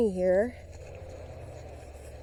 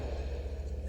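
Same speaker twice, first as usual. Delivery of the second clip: breathy frightened gasp; 10 dB quieter than usual. Pretend. Spots the pretend beaver.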